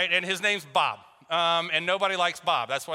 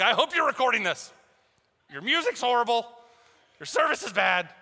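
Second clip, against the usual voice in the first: mock rant; 5 semitones higher than usual. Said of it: "They had an earful".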